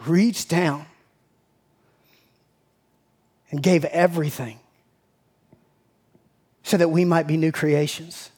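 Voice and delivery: low, subdued prayer voice; prayerful. Real, not acted. Pastor closing a prayer of thanks to the Lord, prayerful. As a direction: pray gently and low, in reverent thanks.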